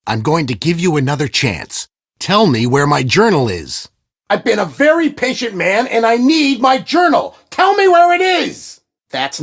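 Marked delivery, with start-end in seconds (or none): 4.3-8.8 building anger. Acted. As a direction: let the threat boil over. Villain losing patience with Nancy.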